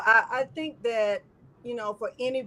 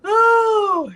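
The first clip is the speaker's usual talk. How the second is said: emotional cry; 11 semitones higher, 8 dB louder than usual. Real, not acted. Overwhelmed cry out.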